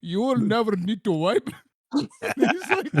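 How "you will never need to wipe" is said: Morpheus. Acted. Morpheus reassuring Neo, comic Indian accent. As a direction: pronounce it gravely in an Indian accent. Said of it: "And then Morpheus is like".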